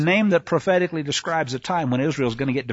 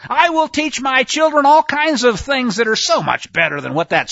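lightly voiced scornful harlot, higher and louder; mocking the harlot; 6 semitones higher, 4 dB louder than usual. Preacher mocks the faithless nation, a touch of put-on voice. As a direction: sneer it as the defiant unfaithful wife. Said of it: "she said".

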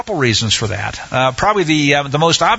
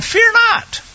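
much higher, pleading comfort; kind reassurance. Acted. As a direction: urge them gently not to fear. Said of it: "he spoke kindly to them".

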